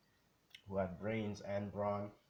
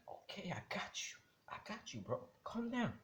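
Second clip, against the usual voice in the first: hushed whisper; 12 semitones higher, 5 dB quieter than usual. Acted. Calming an agitated partner.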